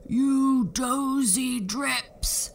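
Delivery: very slow, loud giant roar. A narrator's reading. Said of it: "roared the giant".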